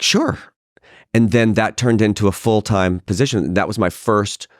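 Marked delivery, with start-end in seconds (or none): 0.0-0.4 eager yes. Retold or imitated. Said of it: "I was like".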